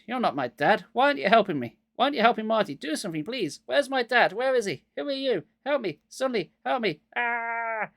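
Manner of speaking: high frantic pleading; panicked. A narrator's reading. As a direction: beg in panic. Panicked plea.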